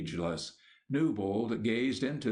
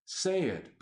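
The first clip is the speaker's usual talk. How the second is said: high, urgent plea; pleading; 7 semitones higher than usual. A narrator's reading. Newbold begging the bishop to say it, pleading. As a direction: beg him to speak. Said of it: "pleaded Newbold".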